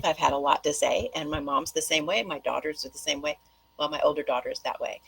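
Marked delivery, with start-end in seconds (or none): none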